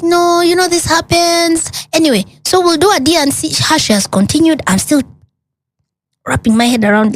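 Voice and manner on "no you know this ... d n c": high, offhand doctor voice; breezy doctor. Retold or imitated. Doctor to grieving patient, high dismissive voice. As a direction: brush it off lightly, high and quick at the end. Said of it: "And she's like".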